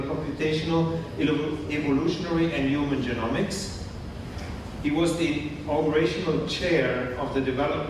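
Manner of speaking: usual speaking voice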